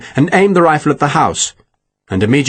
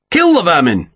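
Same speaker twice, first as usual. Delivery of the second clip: higher-pitched battle order; furious command; 6 semitones higher than usual. A narrator's reading. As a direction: urge the gunners on angrily. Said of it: "He turned furiously on the gunners".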